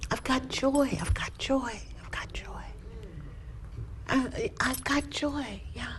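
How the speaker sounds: a high voice fading down to a whisper; hushed joy. Retold or imitated. Alone in the car, discovering joy, fading to a whisper.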